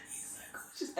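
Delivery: hushed, voiceless gush; swooning whisper. Real, not acted. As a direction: whisper it, overcome by the crush. Gushing over her crush.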